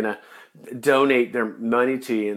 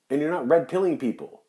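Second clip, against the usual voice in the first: ordinary talk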